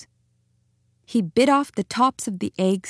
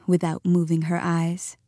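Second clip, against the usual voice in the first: plain reading voice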